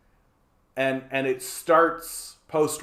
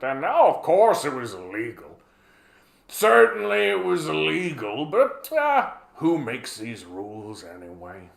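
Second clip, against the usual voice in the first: John Bolton impression. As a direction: casually boast like a mock veteran schemer. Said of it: "just casually admitting to it".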